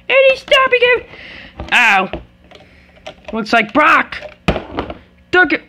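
high, hyped wrestling commentator; play-by-play commentary. Pretend. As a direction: call the fight live. Live play-by-play of toy fight.

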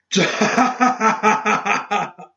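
high, rapid-fire mad cackle; long burst of crazed staccato laughter; maniacal laugh. A narrator's reading. Seamus overtaken by the grave spirit, cackling maniacally. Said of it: "He laughed maniacally".

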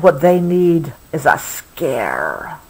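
ordinary delivery

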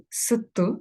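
ordinary talk

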